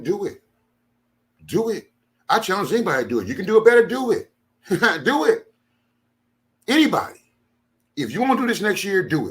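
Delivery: high, loud daring shouts; taunting challenge. Real, not acted. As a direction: throw the dare at every critic. Daring critics to host the event.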